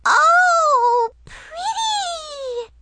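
very high delighted squeal; gleeful squeal. Acted. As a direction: squeal with glee. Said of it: "she squealed with glee".

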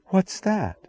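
high, soft gasp of astonishment; gasped question. A narrator's reading. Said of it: "she gasped".

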